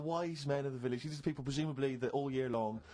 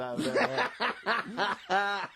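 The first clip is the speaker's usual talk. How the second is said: laughing; 6 semitones higher, 6 dB louder, much slower than usual. Real, not acted. Joking about monkeys, laughing.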